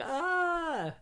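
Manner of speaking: high, held mock scream at talking volume; drawn-out high-pitched "ah"; imitated cry. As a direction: imitate a comic yell of someone hit in the face. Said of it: "causing him to go, like".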